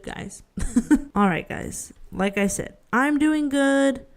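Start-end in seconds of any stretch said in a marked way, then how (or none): 2.9-4.0 slow, deliberate mock-reassuring; drawn-out I'm doing good; slow insisting. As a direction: say it slowly to convince them.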